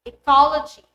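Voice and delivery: eCOLogy, COL punched loud; stress on second syllable. Shown as a demonstration. Teacher giving the base word, eCOLogy stressed on COL. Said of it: "Let's have this as examples".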